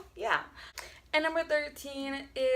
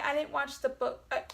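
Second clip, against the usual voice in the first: ordinary talk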